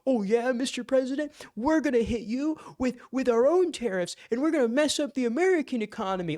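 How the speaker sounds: high, loud mocking bravado; Colombia taunting the President, mocking high voice; mock tough talk. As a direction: puff up like a fake tough guy. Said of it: "They're like".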